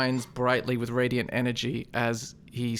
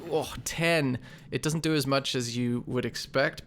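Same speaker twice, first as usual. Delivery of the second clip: ordinary talk, nothing marked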